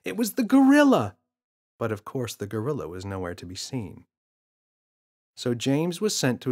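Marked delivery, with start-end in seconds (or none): 0.0-1.2 put-on higher, louder kid voice insisting it's real; a frustrated boy pleading to be believed; insistent boy. James voiced in story.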